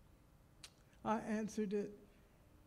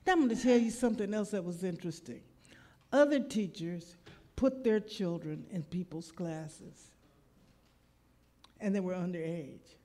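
loud, fast preaching lead-in; 8 dB louder than usual. Acted. Preacher tells the congregation a new story, loud and quick. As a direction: push it out to the crowd.

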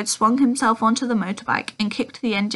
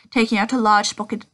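plain reading voice

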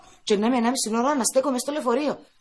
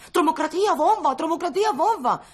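mock shrieking; 6 semitones higher than usual. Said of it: "να τσιριλά".

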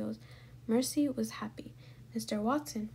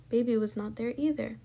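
usual speaking voice

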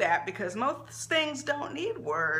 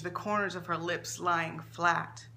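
ordinary delivery, nothing marked

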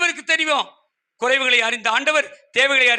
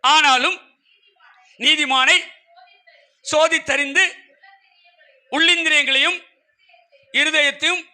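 raised voice, phrase by phrase with long pauses; scripture proclamation; 3 semitones higher, 3 dB louder than usual. Acted. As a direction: declaim the verse firmly to the congregation. (வசனத்தை உரத்த குரலில் நிறுத்தி நிறுத்தி வாசிப்பது)

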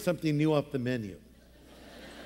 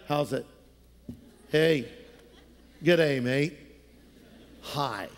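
low-key casual greeting; 5 semitones lower than usual. Retold or imitated. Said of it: "It's so low key".

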